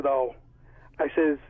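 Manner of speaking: ordinary delivery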